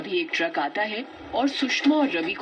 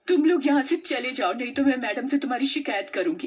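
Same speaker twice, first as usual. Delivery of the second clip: angry retort; 5 semitones higher than usual. Acted. Pooja angrily threatens the bullies with the teacher. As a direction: tell the bullies off, furious. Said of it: "पूजा को गुस्सा आ जाता है".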